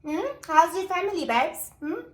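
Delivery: high, sly nosy questioning; mock probing. Friend teasing her ex about his family, sly high voice. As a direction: needle him sweetly about his family. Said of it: "she's like".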